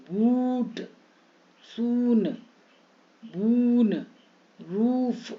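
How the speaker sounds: held "oo" in बूट, सून, बून, रूफ; long oo vowel. Shown as a demonstration. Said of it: "बड़ी ऊ".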